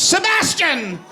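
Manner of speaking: shrill high shout of a name, laughing; high mock shriek, calling out; shrieking lady pirate. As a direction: screech it like a zany pirate lady calling her hawk. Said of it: "again she goes".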